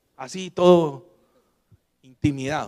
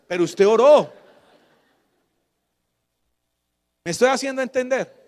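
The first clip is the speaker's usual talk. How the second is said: loud, high, fervent preaching with call-and-response; full-force preaching; 4 semitones higher, 5 dB louder than usual. Acted. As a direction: preach it out to the whole church, rising and pounding. Preacher exhorting the congregation.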